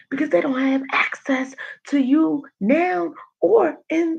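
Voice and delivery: high, fervent sermon voice; impassioned preaching. Real, not acted. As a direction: preach it with fire. Preaching about gossip.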